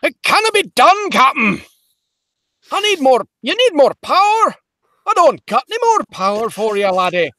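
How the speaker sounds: put-on high, loud, straining Scottish accent; panicked Scottish engineer pleading with his captain; Scottish Scotty yell. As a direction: yell it in desperate Scottish brogue. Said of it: "Scotty yell at Kirk".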